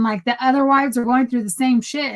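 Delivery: ordinary delivery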